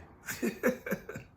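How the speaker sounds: high breathy chuckle voiced as God; God's high laugh. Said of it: "I know he's sitting there laughing".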